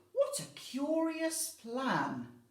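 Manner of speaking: loud, slow wondering voice; puzzled old lady. Acted. Old lady doubting the plan, slow puzzled voice. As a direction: mull it over doubtfully. Old lady's line continues.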